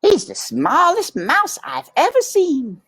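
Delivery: put-on high, fussy old aunt voice; Aunt Florence. A narrator's reading. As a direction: squeak it out like a prim old aunt mouse. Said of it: "said his Aunt Florence".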